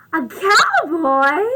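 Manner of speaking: loud, slow, thrilled question; light up at the cowboy; excited Gerald. Acted. Gerald spotting Piggy the cowboy, loud excited question. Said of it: "And Gerald says".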